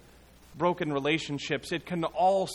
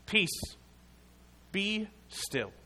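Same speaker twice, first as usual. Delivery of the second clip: higher, firm command to the storm; 5 semitones higher, 4 dB quieter, slower than usual. Acted. Jesus commanding the storm as if casting out a demon. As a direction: order the storm to be still. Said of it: "as if commanding a demon to depart".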